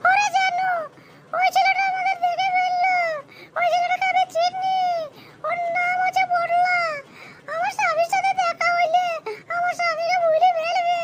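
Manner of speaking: frightened wife pleading with her lover; panicky wife voice. Acted. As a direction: play the caught-out wife, high and fretting. Male performer voices wife.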